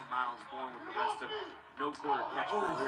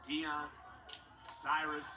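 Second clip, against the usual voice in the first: wrestling commentator; 5 dB louder than usual. Acted. Live play-by-play call.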